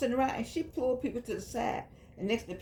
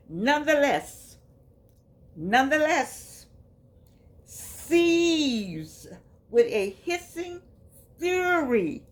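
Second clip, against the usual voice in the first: dramatic emphasis; 5 semitones higher, 6 dB louder, much slower than usual. Acted. Dramatic reading of the passage.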